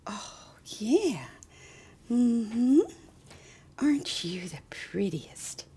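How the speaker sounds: dote on the doll in a hushed coo; cooing baby talk. Acted. Doll collector admiring her doll in a hushed high coo.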